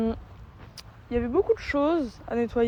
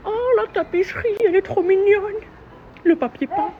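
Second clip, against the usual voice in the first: gushing; 8 semitones higher than usual. Real, not acted. Gushing over wallpaper.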